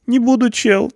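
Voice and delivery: high whiny plea; pleading. A narrator's reading. Punk promises not to be dangerous, high plea. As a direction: start begging. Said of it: "взмолился тот".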